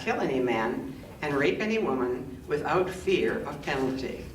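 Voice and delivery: ordinary talk, nothing marked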